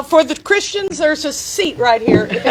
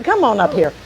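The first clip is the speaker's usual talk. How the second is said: usual speaking voice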